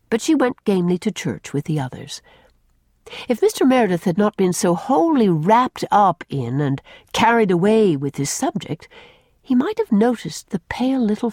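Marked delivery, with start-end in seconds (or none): none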